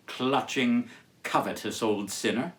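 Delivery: usual speaking voice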